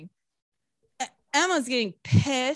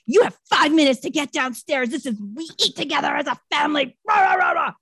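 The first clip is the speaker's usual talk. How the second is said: loud, high, hammed-up nagging rant; mock scolding mother; 5 semitones higher, 3 dB louder, faster than usual. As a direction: bark like a horrible mother, dissolving into throat-scraping noise. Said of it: "she's horrible to her".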